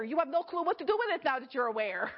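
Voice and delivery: normal talking voice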